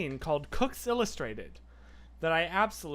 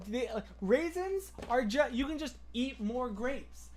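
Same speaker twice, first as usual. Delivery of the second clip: ordinary talk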